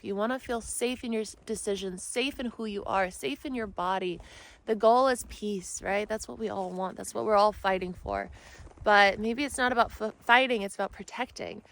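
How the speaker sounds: usual speaking voice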